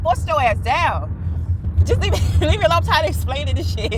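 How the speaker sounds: mock-exasperated, laughing through it; half-laughing shoo-away; laughing exasperation. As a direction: brush him off with a giggle. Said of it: "I just be like".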